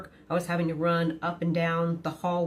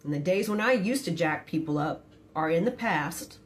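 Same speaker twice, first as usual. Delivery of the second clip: ordinary talk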